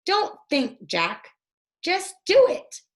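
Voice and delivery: a lightly voiced eager girl; little sister urging. A narrator's reading. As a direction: urge him on like a bossy little sister. Annie urging Jack.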